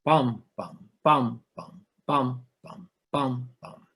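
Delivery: bum, bum chanted with the first bum hit hard and the second light; trochaic beat. Shown as a demonstration. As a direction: tap out the long-short rhythm. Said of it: "these meters are what's called trochaic".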